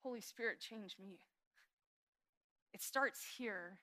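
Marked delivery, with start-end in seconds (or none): 0.0-1.2 softer, fading plea to the Spirit; hushed prayer. Acted. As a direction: let the prayer sink to a near hush. Quiet prayer after pause.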